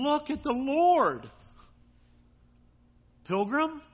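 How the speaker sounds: high, loud, drawn-out exhortation; calling out. Acted. Psalm's author urging the pilgrim to look, calling out. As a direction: call it out slowly and high.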